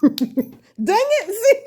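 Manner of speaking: real laughter breaking into high-pitched words; laughing exasperation. Real, not acted. Crafter to viewers after dropping the needle, laughing exasperation. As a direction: squeal it out through helpless laughter.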